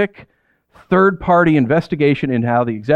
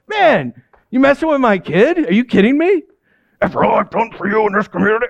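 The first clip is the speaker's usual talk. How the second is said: mock indignation; 6 semitones higher than usual. A dad confronting the school over his kid, mock indignant. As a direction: play the offended dad, half in jest.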